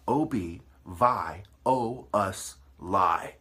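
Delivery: "O-B, Vi, O, Us, Lie" chopped into separate loud syllables; spelled syllable by syllable. Shown as a demonstration. Lecturing viewers, breaking a word into loud syllables. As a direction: sound out "O-B, Vi, O, Us, Lie" piece by piece, each syllable punched. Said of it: "Break down the word".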